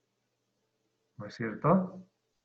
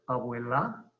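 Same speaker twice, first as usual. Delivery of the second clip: "abuela" stressed on the last syllable, abue-LÁ, rising; wrong stress on LA; 2 semitones lower, 4 dB quieter, slower than usual. Shown as a demonstration. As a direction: throw the weight onto the end of "abuela". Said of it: "No es abuela". ("abuela" acentuado al final, abuelá)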